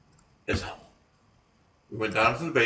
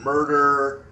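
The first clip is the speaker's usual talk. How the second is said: slow, drawn-out, louder machine word; mock spirit-box voice, very slow; imitating eerie device voice; 4 semitones higher, 6 dB louder than usual. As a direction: stretch it out like an eerie talking gadget. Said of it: "this eerie, echoey voice".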